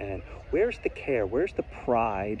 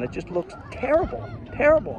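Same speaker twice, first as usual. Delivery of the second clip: real exasperation, pitched much higher; exasperated; 12 semitones higher than usual. Real, not acted. Man complaining about neglected park windscreens, exasperated. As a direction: complain in high, emphatic disgust.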